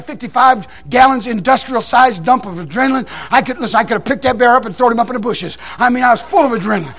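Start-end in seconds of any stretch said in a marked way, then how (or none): none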